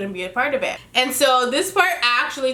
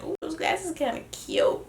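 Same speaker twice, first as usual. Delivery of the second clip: soft admiring aside; 4 semitones higher, 8 dB quieter than usual. Retold or imitated. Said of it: "I was like".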